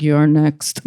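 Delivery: low, ominous threat; menacing low. Retold or imitated. Dad menacing look.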